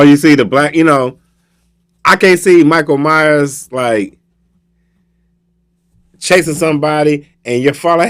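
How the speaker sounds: normal talking voice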